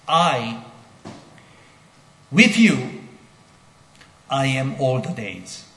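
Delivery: halting emphatic literal rendering; word by word with pauses. Shown as a demonstration. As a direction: space out "I, with you, I am all the days". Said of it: "That's a literal, uh, translation".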